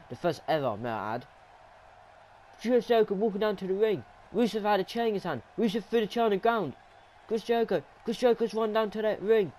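high, excited match commentary; high-pitched, thrilled live commentary; astonished play-by-play. Real, not acted. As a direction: call the surprise arrival as it happens. Surprise entrance called live.

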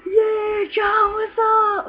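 high, drawn-out holler; gleeful call. Retold or imitated. Jumper hailing John off the bridge, gleeful call. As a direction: stretch it out like calling from mid-jump. Yelling while jumping off bridge.

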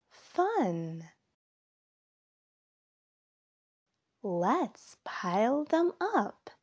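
lively storytime read-aloud; reading to children. A narrator's reading. Narrator reading a fall story to young listeners, storytime voice. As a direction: tell it like a picture book for little kids. Reads picture book aloud.

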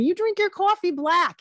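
put-on high, loud New Jersey accent; imitated New Jersey guy, punching it out; Ray Liotta. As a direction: lean hard into the New Jersey vowels. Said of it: "He has a bit of an accent".

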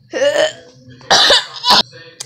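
sudden loud cough. Real, not acted. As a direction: cough sharply. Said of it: "sorry, I coughed".

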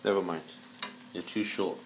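normal talking voice

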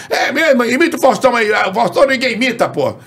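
high-pitched radio host impression; Antônio Carlos. Radio host daring the imitator to do one, high-pitched impression. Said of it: "Antônio Carlos".

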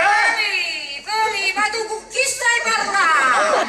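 loud, high indignant yell; indignant shout. Acted. Shouting back at Don Felice in outrage. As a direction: bellow at him in outrage.

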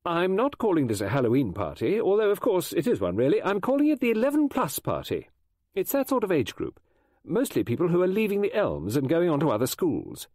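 high, loud organiser's voice; lively character voice, high and emphatic; brisk party hostess. Acted. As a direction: announce it firmly, pitched well up.

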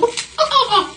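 high-pitched burst of laughter; laughing. Real, not acted. Laughs at the broken straw. (risa aguda y espontánea)

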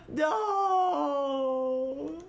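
high gasp then wailing no; dramatic gasp and cry. Acted.

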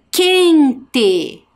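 quente said loud and high; emphatic reveal. Acted. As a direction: announce the word with flair. Teacher reveals new word. (anunciando a palavra com ênfase)

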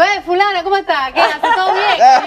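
casual greeting. Retold or imitated. Uruguayan friend greeting her mother-in-law, lightly laughing. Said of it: "Le digo".

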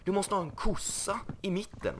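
mock TV carpenter scolding the builder; Arga snickaren. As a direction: boss the house around like a grumpy carpenter. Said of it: "Vi får ringa arga snickaren snart".

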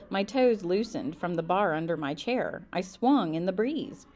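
usual speaking voice

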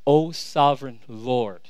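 low, slow, solemn invocation; deep reverence. Acted. Abram coming before the Lord ahead of his complaint, with reverence. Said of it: "he says it with such reverence".

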